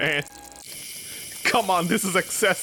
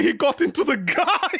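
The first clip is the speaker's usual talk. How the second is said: laughing; 7 semitones higher than usual. Real, not acted. Laugh heard.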